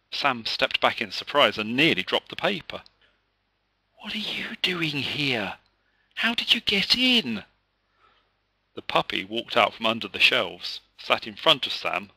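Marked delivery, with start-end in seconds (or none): 4.0-5.6 hushed surprised whisper. A narrator's reading. 6.1-7.4 surprised. A narrator's reading. Surprised child to puppy.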